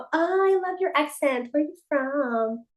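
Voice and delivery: high, slow gushing American voice; mock-enthusiastic American asking where she's from. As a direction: coo it like an excited stranger. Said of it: "apenas escucha tu acento y es como".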